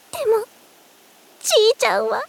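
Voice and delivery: high, distressed voice; upset insistence. Acted. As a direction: push the words out high, close to tears. Upset, defending grandfather's word.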